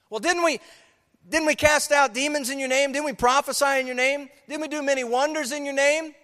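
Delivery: high, loud, indignant protest; pleading protest. Acted. The rejected plead with Jesus, loud and indignant. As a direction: plead your case as if wronged.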